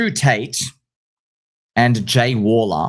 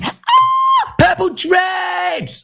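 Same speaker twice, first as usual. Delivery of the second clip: yelling; much slower than usual. Shown as a demonstration. Showing viewers how he yells on camera, a drawn-out shout. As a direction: bellow it out, stretched and slow. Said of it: "I'm, like, yelling".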